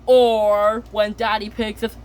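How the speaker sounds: ordinary talk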